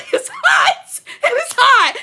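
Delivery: high, loud, laughing about the heat; laughing complaint. Real, not acted. Complaining about hot kitchen.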